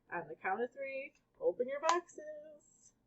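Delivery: lightly hammed game-show host; host announcing. A narrator's reading. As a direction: stretch it out like a TV host. Game show host giving instructions.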